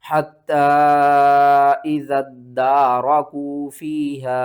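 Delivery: strong, sustained Arabic recitation; Quran recitation. Shown as a demonstration. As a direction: recite firmly, holding each long vowel. Recites corrected phrase in full.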